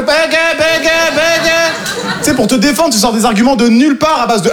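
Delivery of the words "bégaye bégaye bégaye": high, repeated vulture-like jeer; mocking taunt. Family pouncing on a stutter, high mocking taunt. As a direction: jeer it over and over.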